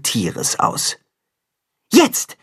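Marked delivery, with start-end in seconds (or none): 1.9-2.4 signal call. A narrator's reading. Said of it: "gibt Timme das Zeichen".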